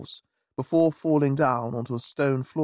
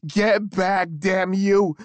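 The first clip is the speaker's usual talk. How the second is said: furious shout, higher and louder; 7 semitones higher, 5 dB louder, slower than usual. Acted. Baker shouting as he lunges at the stranger. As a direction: roar it as you lunge forward. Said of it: "he shouted and lunged".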